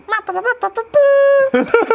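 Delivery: repeated high squawks ending in a long blare; imitated cow call. Hunter mimicking his buddies' cow call, loud high squawks. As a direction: honk like a badly blown call.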